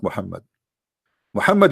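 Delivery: ordinary talk, nothing marked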